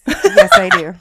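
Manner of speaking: high, loud proud yes; excited shout. Real, not acted. Proudly confirming the chloroform shirt.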